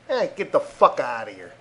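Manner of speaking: normal talking voice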